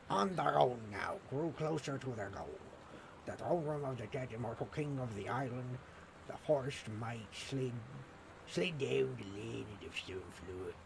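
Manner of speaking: put-on hesitant, tentative pirate accent; attempted pirate accent. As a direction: narrate with a half-hearted pirate accent. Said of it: "how do I do a pirate accent?".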